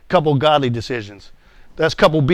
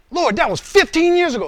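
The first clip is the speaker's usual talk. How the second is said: high-pitched, indignant protest to God; acted-out whiny objection; 11 semitones higher, 3 dB louder than usual. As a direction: plead your excuse in a high voice. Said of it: "listen to my responses".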